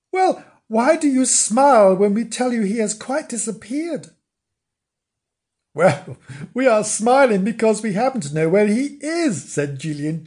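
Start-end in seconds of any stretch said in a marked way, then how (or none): 0.1-4.1 high, baffled questioning; surprised puzzlement. Acted. Frank asking why the children smile, surprised. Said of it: "said Frank in surprise". 5.7-9.5 put-on high, knowing little-girl voice; amused Gillian. Gillian revealing she knows where Bernard is, amused. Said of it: "Gillian with a laugh".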